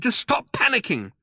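high, tense plea; exasperated urging. A narrator's reading. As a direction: cut off the panicker. Ford cuts off Arthur's rant.

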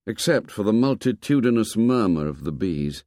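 ordinary delivery, nothing marked

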